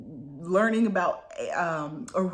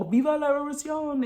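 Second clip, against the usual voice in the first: mock revolutionary yell; 5 semitones higher, faster than usual.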